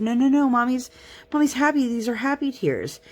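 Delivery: put-on high, soothing reassurance to a toddler; reassuring mommy voice. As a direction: comfort a confused two-year-old, sweetly. Mother comforting crying toddler.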